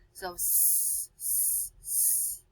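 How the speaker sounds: "s" hissed with no voice; hissing s. Shown as a demonstration. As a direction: repeat the hiss "s". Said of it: "What letter makes that sound?".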